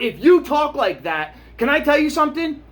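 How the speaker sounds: worked-up rant at the anti-maskers; heated rant. Real, not acted. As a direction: tell them off, all fired up.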